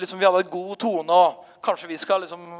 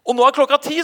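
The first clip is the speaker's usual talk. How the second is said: higher and louder, thrilled; excited announcement; 7 semitones higher, 6 dB louder, faster than usual. Retold or imitated. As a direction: announce it with a burst of joy. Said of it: "sa hun". (kvinnen forteller predikanten begeistret, høyere og sterkere)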